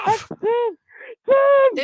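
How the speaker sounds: rising to a loud, high squeak; high squeaky voice. Real, not acted. Caller insisting on his name to mishearing host, high squeaky voice. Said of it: "had a high, squeaky voice".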